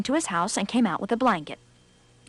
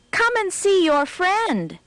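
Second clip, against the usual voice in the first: calling out; 9 semitones higher, slower than usual. Acted. Rabbit calls to fox.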